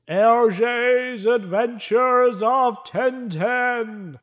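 loud, high, drawn-out title call; TV title announcer. Acted. Podcaster mimicking the Tintin cartoon intro, grand announcer call. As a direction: boom out the cartoon's opening title, stretching every word.

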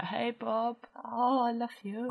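soft, coy swooning over a celebrity; hushed, flirty hero worship; besotted fan. Acted. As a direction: coo it softly like a smitten fan. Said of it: "be all like".

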